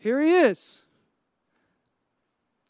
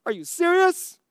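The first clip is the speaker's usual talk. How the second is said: mock outrage; 8 semitones higher, 5 dB louder than usual. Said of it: "I would have been like".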